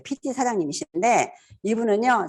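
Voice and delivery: ordinary delivery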